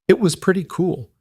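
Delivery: ordinary delivery, nothing marked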